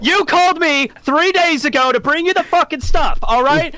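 a fed-up courier scolding the boss; exasperated rant. Acted. Player's courier rants at boss.

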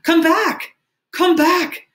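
very high, loud, desperate yell; frantic scream. A narrator's reading. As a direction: let the voice rise wildly. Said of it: "his voice rose insanely".

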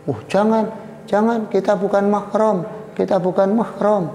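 high-pitched, flustered refusal; panicky mock plea. Young man refusing the princess's advances, comically panicked.

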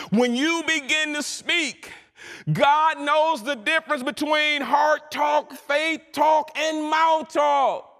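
high, drawn-out preacher's cry; impassioned preaching. Real, not acted. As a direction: proclaim it with rising fervour. Preaches at full force.

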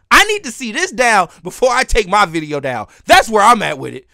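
indignant, emphatic rant; really pissed off. Real, not acted. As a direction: argue the grievance hard, loud on key words. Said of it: "I am pissed".

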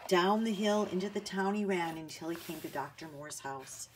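usual speaking voice

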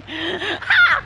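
loud, high mock bark; sudden doggy "ha"; imitated dog bark. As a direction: bark it out through the giggles. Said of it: "Your dog is so funny".

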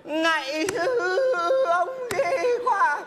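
high, slow wailing plea; strained, breathless, pitiful begging; wailing beggar. Shown as a demonstration. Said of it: "bà phải xin như con này".

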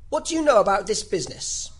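forceful royal interrogation; interrogating King. Acted. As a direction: demand an answer from the witness. King interrogates Alice in court.

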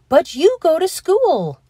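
surprised objection; puzzled. A narrator's reading. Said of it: "Mister Keene said".

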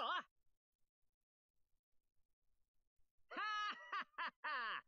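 loud proud laugh; haughty laugh. Acted. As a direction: laugh like you've already won. Victory laugh.

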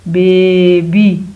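Baby very slow and loud, first syllable held long; stretched-out blending. Shown as a demonstration. Teacher blending sounds for pupils, stretched-out word. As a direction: hold the बे of baby, then land on बी.

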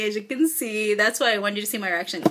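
ordinary talk, nothing marked

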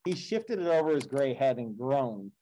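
steady, measured read-aloud of an epic; story narration. A narrator's reading. Teacher reading the Odyssey to the class, measured storytelling.

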